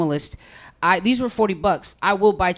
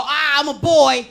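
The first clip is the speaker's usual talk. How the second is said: mock correction; 7 semitones higher, 7 dB louder, slower than usual. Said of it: "I didn't turn around and go".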